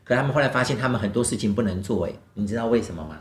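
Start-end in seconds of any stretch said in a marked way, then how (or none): none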